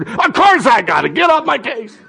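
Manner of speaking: high-pitched mock irritation; yelling back. Annoyed texter answering a nagging follow-up, mock yell. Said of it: "they yell back at you".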